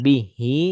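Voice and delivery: bihi with a doubled b; stressed bihi. Shown as a demonstration. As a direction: say bihi with a slight tasdid. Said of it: "Hati-hati agak tasdid bihi".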